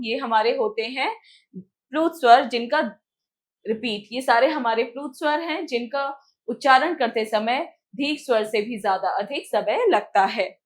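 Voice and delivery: normal talking voice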